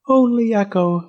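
high, plaintive little voice; sad faint voice. A narrator's reading. Voice from inside the egg answering Fletcher, sad and high. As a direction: answer sadly, pitched well up.

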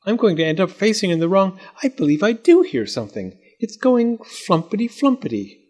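high storybook voice; lightly voiced doll; character voice.

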